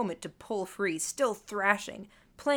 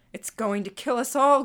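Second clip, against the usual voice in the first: loud, higher cry of alarm; raised, urgent warning shout; panicked scream; 4 semitones higher, 8 dB louder than usual. A narrator's reading. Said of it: "Gordon screamed".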